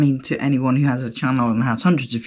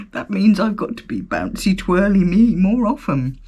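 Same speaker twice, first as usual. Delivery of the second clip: higher, comic reluctance; mock dread; 5 semitones higher, 3 dB louder than usual. Real, not acted. Telling listeners she must be bouncy more often, mock dread. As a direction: groan it out as a funny burden.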